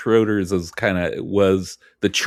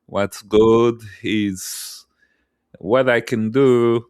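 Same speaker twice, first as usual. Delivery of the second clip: lightly voiced German waiter, slow and stiff; a light mock German accent, slowed down; slower than usual. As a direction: play a stiff new German server, slowly. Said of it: "suddenly they're like".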